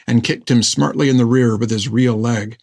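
plain reading voice

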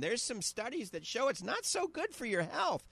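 ordinary delivery

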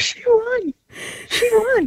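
high, delighted exclaim; telling the office the great news; joyful cry. Real, not acted. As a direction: call it out with joy. Sister announcing to her office.

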